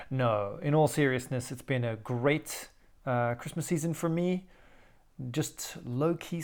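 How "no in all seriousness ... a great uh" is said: normal talking voice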